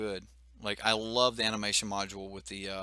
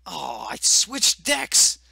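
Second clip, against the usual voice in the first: surprised exclamation; 6 semitones higher, 13 dB louder, much slower than usual. Real, not acted. Realises wrong deck loaded.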